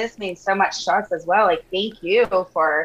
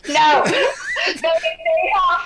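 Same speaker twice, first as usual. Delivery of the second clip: laughing; 12 semitones higher than usual. Real, not acted. Laughing at the covers joke.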